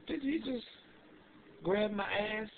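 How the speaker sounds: high, hushed shock; incredulous. Retold or imitated. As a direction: ask in disbelief. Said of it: "I'm like".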